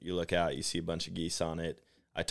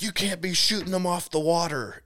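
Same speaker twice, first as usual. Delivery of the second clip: high, louder mock yell; retold yelling husband; 11 semitones higher, 5 dB louder than usual. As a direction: imitate an irate husband hollering a protest. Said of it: "they came out yelling".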